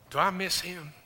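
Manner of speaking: ordinary talk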